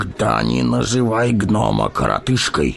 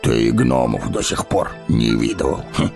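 contemptuous snort. A narrator's reading. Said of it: "презрительно фыркнул Гимли".

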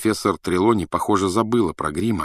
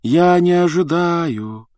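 high, slow, drawn-out singsong; 8 semitones higher, slower than usual. A narrator's reading. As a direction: draw the vowels out like a mystic teacher chanting. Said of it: "говорила она нараспев". (нараспев, тягуче)

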